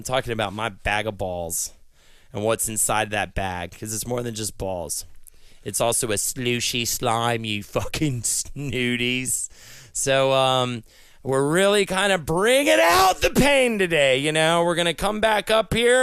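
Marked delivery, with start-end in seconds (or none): none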